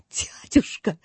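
pleading whisper, breathy and soft; a soft, begging whisper. A narrator's reading. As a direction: beg him under your breath. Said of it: "взмолился племянник".

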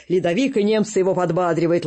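usual speaking voice

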